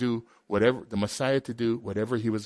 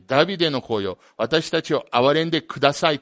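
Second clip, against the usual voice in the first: loud, pleading cry; 必死に叫ぶ声で; crying out for mercy; 3 semitones higher, 6 dB louder, faster than usual. Retold or imitated. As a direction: beg for mercy at full voice. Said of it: "と叫んだ".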